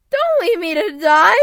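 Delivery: loud, high pleading cry; panicked shout from the freezing water; desperate shout. Acted. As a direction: beg the children not to run off. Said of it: "shouts Mister Grunkle".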